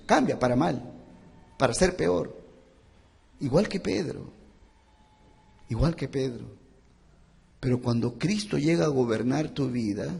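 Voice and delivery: ordinary talk, nothing marked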